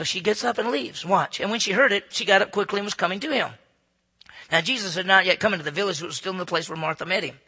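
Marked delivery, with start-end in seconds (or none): none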